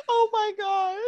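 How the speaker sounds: high-pitched, cracking up; helpless laughter. Real, not acted. Co-host reacting to the punchline, helplessly laughing. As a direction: squeal it through real laughter.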